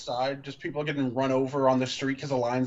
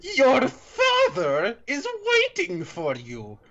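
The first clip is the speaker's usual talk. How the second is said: much higher, slow, louder menacing line; through his teeth; 12 semitones higher, 5 dB louder, much slower than usual. Acted. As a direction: spit it out through clenched teeth. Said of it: "he said through his teeth".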